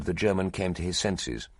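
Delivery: ordinary delivery, nothing marked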